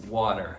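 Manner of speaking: "water" with a flapped T; American water. Shown as a demonstration. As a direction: flap the T in "water". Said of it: "Pros americanos".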